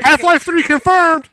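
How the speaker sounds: mock hype proclamation. Friend jokingly proclaims the sequel: mock grand announcement. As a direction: announce it like a trailer voice.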